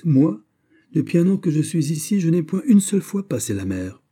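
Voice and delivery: indignant outcry; exclaiming. A narrator's reading. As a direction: protest hotly that you never left the island. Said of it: "s'écria Aramis".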